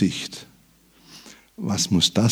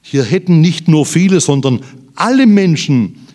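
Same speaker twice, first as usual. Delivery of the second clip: louder, higher, emphatic pulpit voice; forceful preaching; 8 semitones higher, 10 dB louder than usual. Acted. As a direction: drive the point home to the congregation. Predigt mit Nachdruck. (mit Nachdruck gepredigt)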